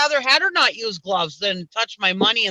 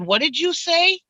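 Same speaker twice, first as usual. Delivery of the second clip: incredulous; 10 semitones higher than usual. Retold or imitated. Disbelief at clerk's refusal.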